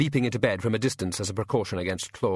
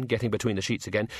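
ordinary delivery

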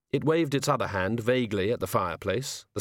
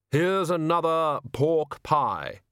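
slow, deliberate solemn figure; slow heavy voice; 6 semitones higher, much slower than usual. Acted. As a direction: weigh each word heavily. Hooded figure talking.

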